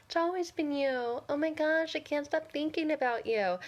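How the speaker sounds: high, whiny mocking voice; mock the ex in an annoyingly high singsong. Coach mocking a toxic ex's sweet talk, annoying voice. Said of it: "I have to do that annoying voice".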